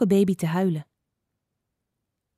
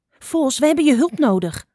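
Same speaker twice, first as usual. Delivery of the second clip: high, urgent call; raised, hurried plea from a little bird; calling back; 6 semitones higher, 5 dB louder than usual. A narrator's reading. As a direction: call out quickly for help. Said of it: "riep Spotlijster terug".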